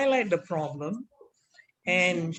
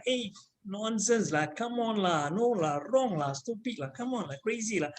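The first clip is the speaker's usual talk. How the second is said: Singlish; 4 dB quieter than usual. Acted. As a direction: scoff in Singlish with lah. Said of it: "act out the role of that person".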